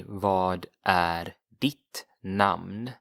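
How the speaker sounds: Vad är ditt namn said very slowly, "vahd air dit nahmn"; teacher models the literal question for students; Swedish question. Shown as a demonstration. Teacher says the question slowly for the class. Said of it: "you could say".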